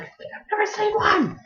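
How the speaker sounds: very high, slowed put-on voice; mock female reply. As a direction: imitate her in a slow, high pitch.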